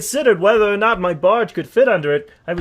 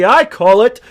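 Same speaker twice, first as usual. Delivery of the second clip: grand announcement; 8 semitones higher, 7 dB louder than usual. Acted. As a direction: announce it like a showman. Announcing his tale dramatically.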